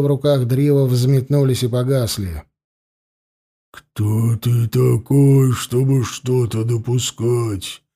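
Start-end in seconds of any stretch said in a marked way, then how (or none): 3.7-7.8 low, slow sepulchral voice; werewolf. A narrator's reading. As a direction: speak like a voice from the grave. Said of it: "зазвучал загробный голос оборотня".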